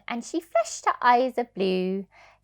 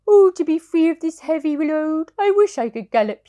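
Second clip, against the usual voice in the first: higher, louder longing sigh of a horse; wistful horse voice; 7 semitones higher, 8 dB louder than usual. Acted. Bedtime reader voicing restless horse's wish. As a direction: yearn for the wide world. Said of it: "he said".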